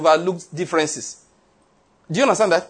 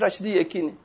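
usual speaking voice

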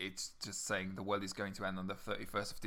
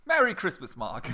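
mock cheerful; 7 semitones higher, 8 dB louder than usual. Sarcastic cheer over gloomy sonnet.

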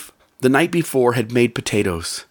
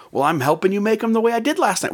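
higher, fast, a touch defensive; defensive wife; 8 semitones higher, much faster than usual. Retold or imitated. Wife justifying herself to her husband in the kitchen, quick and higher. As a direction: rush it out, lightly voicing the wife.

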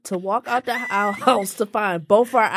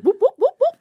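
imitated phone whoop sound; 13 semitones higher, 4 dB louder, faster than usual. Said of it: "the phone was like".

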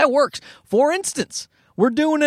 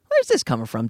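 lowered, probing repeat; low-pitched questioning; 7 semitones lower than usual. Retold or imitated.

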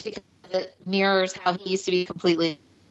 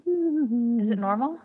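boxy muffled closet sound; talking from inside a closet; 2 semitones higher, much slower than usual. Real, not acted. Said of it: "sounds like you hid in the closet".

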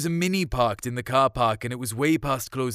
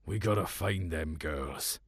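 low, soft and slow; to himself; 7 semitones lower, 6 dB quieter, slower than usual. A narrator's reading. Evans steeling himself after a nip of whiskey, quiet and low. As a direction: murmur it to yourself. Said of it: "more to himself than anyone else".

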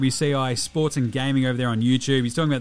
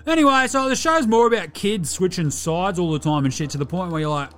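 a cheeky Aussie larrikin with a higher voice; bogan character Delhi; 4 semitones higher than usual. Acted. Delhi does his pop culture review, cheeky larrikin voice. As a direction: play him like a mouthy mate down the pub.

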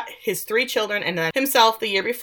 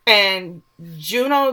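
usual speaking voice

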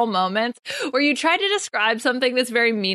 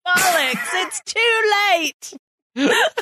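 a jokey British shout; mock British accent; 8 semitones higher, much slower than usual. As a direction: curse it out like a silly Brit, rising to a yell. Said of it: "British impersonating".

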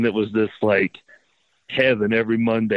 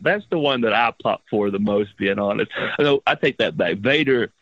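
ordinary talk

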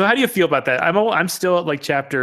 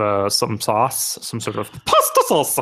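mock Italian accent; 6 semitones lower than usual. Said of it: "I don't know what that accent was".

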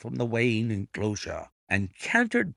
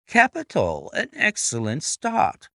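bright burst of approval, rising high; sudden cry; 6 semitones higher than usual. A narrator's reading. Detective watching the race start, suddenly crying out. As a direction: exclaim with sudden delight. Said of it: "he cried suddenly".

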